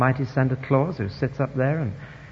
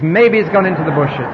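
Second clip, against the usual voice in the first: mocking laugh; 3 semitones higher, 6 dB louder than usual. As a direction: tease them with a laugh in the voice. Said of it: "He's laughing, you see".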